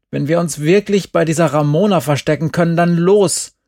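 urgent. A narrator's reading. Glenn urgently pushes to leave.

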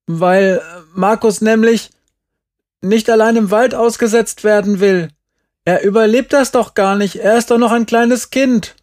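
put-on high, loud child's outburst; high-pitched indignant little boy; loud improvised excuse. A narrator's reading. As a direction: plead the made-up story loudly. Child shouts improvised story at Uwe.

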